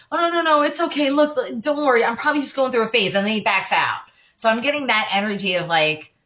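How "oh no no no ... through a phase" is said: higher, mock soothing man backing out; reassuring boyfriend. Boyfriend calming his crying girlfriend, higher mock reassurance. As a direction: imitate him hurriedly calming her, pitched up. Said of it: "and he's like".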